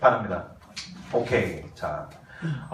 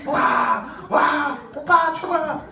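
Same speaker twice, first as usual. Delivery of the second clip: excited; 6 semitones higher, much slower than usual. Shown as a demonstration. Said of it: "이거 연습하라고".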